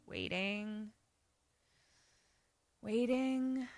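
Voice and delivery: very soft, drawn-out words; hushed, lingering storytelling; suspenseful waiting. Retold or imitated. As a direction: stretch each word into the silence. Acts out long tense wait.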